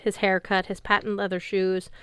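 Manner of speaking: plain reading voice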